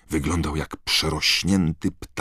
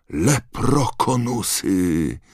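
raised, stretched-out call; 6 semitones higher, 3 dB louder, much slower than usual. A narrator's reading. Mr Weasley naming the creatures over the crowd, calling. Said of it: "zawołał pan Weasley".

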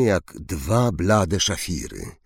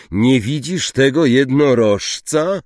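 roar, higher and much louder; 7 semitones higher, 6 dB louder than usual. Acted. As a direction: bellow the question at him. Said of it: "ryknął Firenzo". (Firenzo ryczy na Zakałę, głośno i wysoko)

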